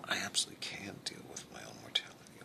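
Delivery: low angry whisper; hushed. Acted. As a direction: hiss it back defensively. Heated exchange, kept down.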